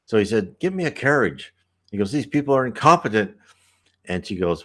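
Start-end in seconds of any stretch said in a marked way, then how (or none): 0.6-1.6 a hint of a bossy man, raised pitch; barked orders. Dead sailor ordering a carriage from the spirit, mild mock bark. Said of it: "just kinda barked orders". 2.1-3.4 quick, louder mock grumble of a bossy man; barked complaint. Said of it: "just kinda barked orders".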